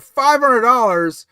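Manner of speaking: loud, high, drawn-out disbelief; incredulous. Real, not acted. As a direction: balk loudly at an outrageous price. Balks at a viewer's price guess.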